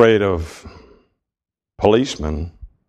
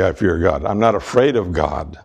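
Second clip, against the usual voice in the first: ordinary talk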